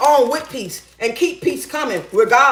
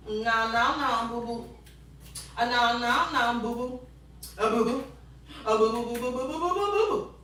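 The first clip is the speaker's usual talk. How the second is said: soft little "boo-boo" chant; playful muttering; 11 dB quieter than usual. Real, not acted. Woman talking to herself in the kitchen, soft playful chanting.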